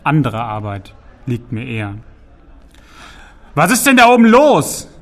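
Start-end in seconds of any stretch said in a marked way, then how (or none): 3.5-4.9 booming troll shout from the cellar; thundering call up from below. Acted. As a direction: roar it up through the floor. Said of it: "donnerte Part im Keller".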